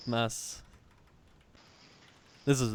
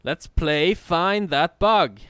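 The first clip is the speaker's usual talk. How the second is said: game show host; 6 semitones higher, 9 dB louder, much slower than usual. Pretend. Announcing a quiz game.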